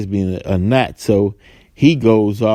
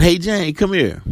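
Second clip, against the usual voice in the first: mock calling out; 8 semitones higher than usual. Joe stops Jane at work, mock calling out. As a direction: mildly mimic a man calling a coworker over. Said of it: "he yells out".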